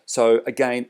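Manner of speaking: ordinary delivery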